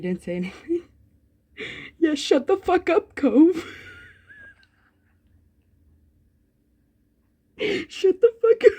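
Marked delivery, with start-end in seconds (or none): 2.0-8.7 laughing yell. Acted. Yelling at character while laughing.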